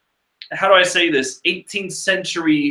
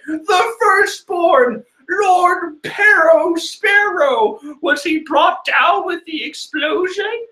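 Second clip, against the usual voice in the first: squeaky high-pitched soldier, slow and drawn out; high silly soldier voice; 14 semitones higher, slower than usual. Acted. Soldier reporting to his captain in a squeaky high voice. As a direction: ham up a shrill little soldier. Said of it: "I found the greatest face to go with that voice".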